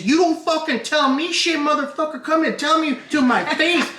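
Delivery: high, hammed-up angry rant; mock a woman going off on a heckler; imitating a woman cursing out a drunk fan; furious cursing.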